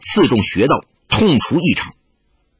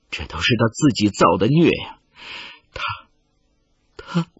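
trembling voice; 5 semitones lower, 7 dB quieter than usual. A narrator's reading. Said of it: "穆九娘声音颤抖，说不下去".

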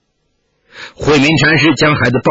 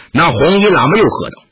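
ordinary delivery, nothing marked

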